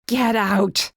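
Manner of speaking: a loud bellow, voice thrown high and hard; now let it all out at her. Acted. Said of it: "I bellowed this time".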